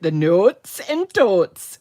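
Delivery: put-on a light, slowed Minnesota accent. Recalling bingo with viewers, light Minnesota accent. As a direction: lean lightly into a Minnesotan drawl on the vowels. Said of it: "with my nice Minnesotan".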